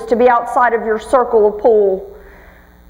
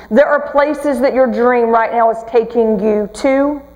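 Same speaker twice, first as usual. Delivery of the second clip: normal talking voice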